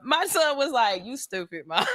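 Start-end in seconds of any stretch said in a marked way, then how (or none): 1.0-1.8 mumbled tease. Retold or imitated. Son mocking mom.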